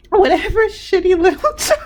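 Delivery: high, voice cracking up with real laughter; laughing. Real, not acted. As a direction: try to speak while laughing to tears. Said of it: "for laughing at you".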